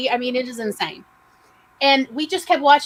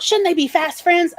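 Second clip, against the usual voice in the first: mock hopeful mothers; 8 semitones higher than usual. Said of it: "So our mothers thought".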